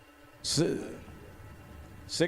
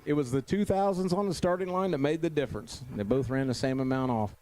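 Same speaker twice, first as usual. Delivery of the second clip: ordinary talk, nothing marked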